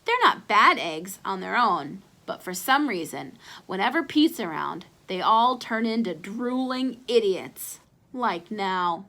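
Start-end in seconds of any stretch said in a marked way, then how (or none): none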